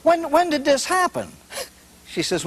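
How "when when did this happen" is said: shocked priest. Acted.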